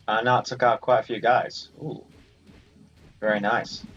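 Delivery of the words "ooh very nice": ordinary delivery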